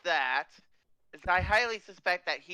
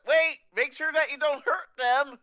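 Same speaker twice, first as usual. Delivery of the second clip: urgent called-out warning, higher voice; quick shout; 8 semitones higher, 8 dB louder, faster than usual. Acted. Xavier quickly shouts to spare the students, urgent shout. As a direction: shout out a hasty caution. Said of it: "Xavier quickly shouts out".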